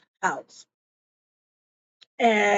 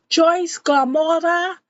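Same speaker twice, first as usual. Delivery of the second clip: high, louder call of a name; bailiff calling out; 7 semitones higher, 5 dB louder than usual. Retold or imitated. Bailiff summoning Joyce at the jail, calling out. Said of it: "And the bailiff goes".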